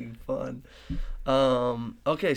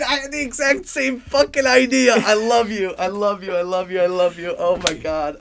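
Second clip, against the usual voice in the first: much higher, louder gushing; overjoyed; 7 semitones higher, 9 dB louder than usual. Real, not acted. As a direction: gush with delight, fading down. Thrilled at shared idea.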